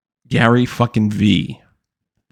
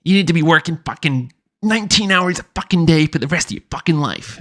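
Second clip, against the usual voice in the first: pushy mock motivational speaker, rising higher as he goes; mocking hustle guru; 5 semitones higher than usual. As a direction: parody a cursing hustle coach pep talk. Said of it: "So he's like".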